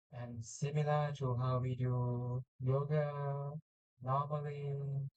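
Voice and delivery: slow, calm meditation voice; guided meditation. Acted. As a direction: guide the breath gently and slowly.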